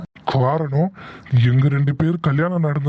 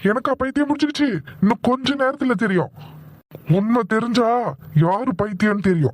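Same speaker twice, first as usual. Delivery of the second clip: higher-pitched, affronted comeback; indignant retort; 4 semitones higher than usual. Acted. Accused of madness, vows truth will come out, indignant. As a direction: throw the accusation back with a threat.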